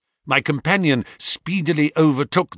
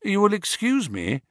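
bland; 8 semitones higher than usual. A narrator's reading. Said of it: "said Holmes blandly".

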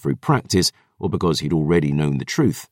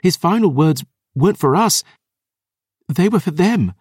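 put-on lightly higher girl's voice, shaking; young woman voice; 11 semitones higher than usual. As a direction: voice Dior, trembling with feeling. Dior continues.

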